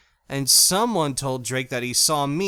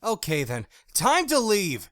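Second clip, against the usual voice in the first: hollering; 8 semitones higher than usual. A narrator's reading. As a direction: bark him off the property. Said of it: "Jackie hollered".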